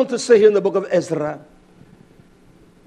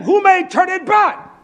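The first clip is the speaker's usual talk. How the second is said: high, raised preaching cry; forceful cry; 10 semitones higher, 4 dB louder than usual. Real, not acted. Preacher quoting the verse to congregation, forceful cry. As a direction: hurl the question out, pitched high. Same question cried, then asked softly.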